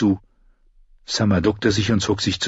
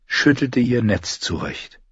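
ordinary delivery

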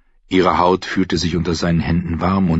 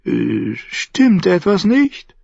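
Shay asking; 13 semitones higher, slower than usual. Acted. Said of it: "fragte sie".

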